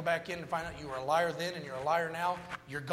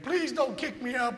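higher-pitched imitated plea; pleading; 7 semitones higher than usual. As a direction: mimic a begging Marine, a bit higher. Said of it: "he pleaded and begged".

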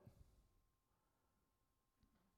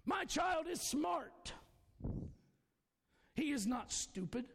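bold declaration; 3 semitones higher, slower than usual. Shown as a demonstration. Faith declarations, slow, raised.

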